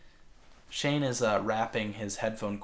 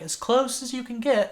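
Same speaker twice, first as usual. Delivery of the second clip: higher, louder instructing voice; lightly voiced bossy technician; mock eye technician; 13 semitones higher, 7 dB louder than usual. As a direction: imitate the tech ordering him closer.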